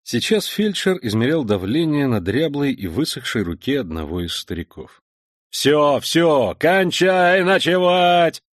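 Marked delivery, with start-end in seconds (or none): 5.5-8.4 loud, slow, annoyed order; irritated shouted command. Acted. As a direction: bellow it like an angry officer. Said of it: "раздражённо прокричал ... зычным командным голосом".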